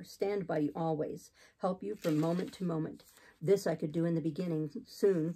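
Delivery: lightly deepened, steady preacher; low, measured clergyman's voice; exhorting missionary. Acted. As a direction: persuade with austere conviction. Voices Saint John.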